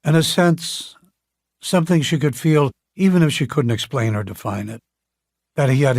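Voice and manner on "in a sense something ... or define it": plain reading voice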